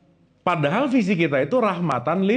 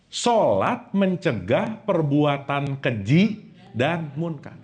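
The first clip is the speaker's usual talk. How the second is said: slow, weighty translation, stress on sholat; slow emphatic; 4 semitones lower, much slower than usual. Shown as a demonstration. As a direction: lay it out word by word. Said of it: "ini paling pentingnya di sini".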